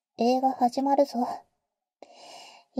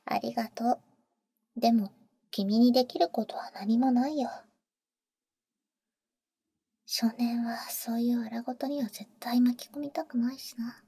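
gentle whisper. Acted. Protecting the boy from danger, gentle whisper. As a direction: reassure him, keep him out of it.

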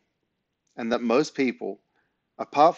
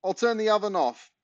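high calling voice; 9 semitones higher than usual. Acted. Dad calling from downstairs.

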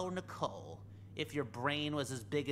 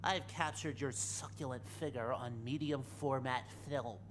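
ordinary delivery

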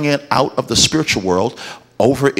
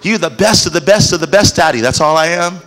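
put-on flattering child, higher; 5 semitones higher than usual. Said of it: "kids have this swagger".

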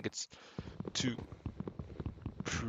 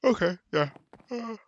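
yawning mid-word; 11 semitones higher, 5 dB louder than usual. Real, not acted. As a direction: talk through a yawn. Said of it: "Sorry if I'm yawning".

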